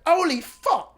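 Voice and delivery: high, loud British exclamation; mock British accent. Storyteller showing how the cleaner said it, British accent. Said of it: "Like, in a British way".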